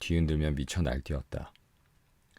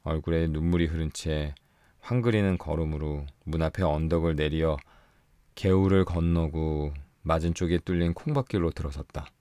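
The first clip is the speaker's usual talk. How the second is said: ordinary delivery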